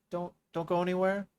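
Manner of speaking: anxious plea. Acted. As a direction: beg her not to leave.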